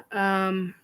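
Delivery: normal talking voice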